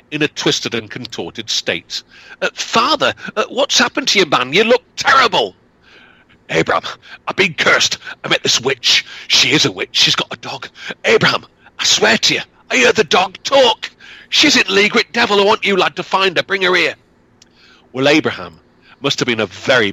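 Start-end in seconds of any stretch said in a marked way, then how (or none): 2.4-5.6 put-on high, alarmed young man; worried son seeing his father; shocked son. Son finds his father twisted. 6.5-17.0 put-on high, agitated old man; lightly voiced frightened father; cursed peddler. Cursed father urges son.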